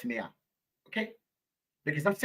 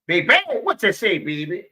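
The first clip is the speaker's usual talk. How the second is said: calling out; 3 semitones higher, 8 dB louder than usual. Real, not acted. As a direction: call her out across the room. Calling out to viewer.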